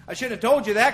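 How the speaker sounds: usual speaking voice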